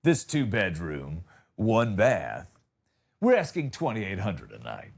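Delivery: low, self-important landlord voice; newly rich landlord. Acted. Augusta homeowner pitching rental to golfers, low mogul voice. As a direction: quote the price in a low, cool voice. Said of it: "You're like".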